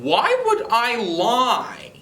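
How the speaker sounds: high, slow pleading why; defending himself to his dad, high and pleading; indignant protest. Retold or imitated. Protesting false accusation to dad.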